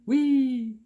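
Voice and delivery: high, loud sarcastic whee; mock whee. Newsman mock-cheering the helicopters, a high loud whee. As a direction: squeal it like a kid on a ride.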